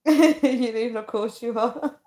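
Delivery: giggling through the words; real laughter breaking in; laughing. Real, not acted. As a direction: laugh as you speak. Laughing while reading chat.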